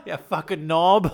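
hammed-up Cockney zoo worker. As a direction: scold in a mock Cockney accent. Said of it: "Who's Cockney".

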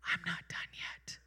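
hushed breathy whisper. Pretend. God whispering a promise to Ruth. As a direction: lean in and breathe it softly. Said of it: "whispers once again".

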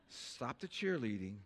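usual speaking voice

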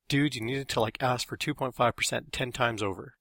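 ordinary delivery, nothing marked

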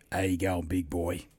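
lightly voiced low, soft greeting; imitating a workmate. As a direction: drop it low and quiet, imitating a bloke. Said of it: "talk like this, he goes".

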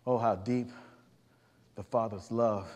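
tender awe. Real, not acted. Preacher marvels at God's love.

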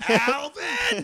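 high loud yell of the name; yelling Alvin. Retold or imitated. Shouts chipmunk's name.

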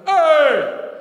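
loud, high imitated bellow; hollered greeting. Preacher imitates Vanessa's second greeting, bellowing. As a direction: holler it out long and big.

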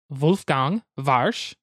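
"Wolfgang Warsch" with German v sounds, Vulfgang Varsh, slowly; German accent. Shown as a demonstration. Host corrects the designer's name in a light German accent. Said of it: "We're doing this in the German accent, kind of".

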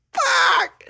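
sudden high squeal; shocked squeak. Real, not acted. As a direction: yelp it in disbelief. Shock at dirty shoes.

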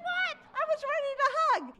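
high, startled mock outrage; comic high-pitched surprise; surprised indignation. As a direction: squeak out the disbelief. Extrovert told to stay away.

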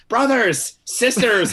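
put-on loud, high oratory in an Irish accent; Magneto. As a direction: cry out like a rallying Irish leader. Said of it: "Michael Fassbender's Irish when he's like".

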